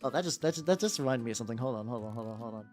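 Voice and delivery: normal talking voice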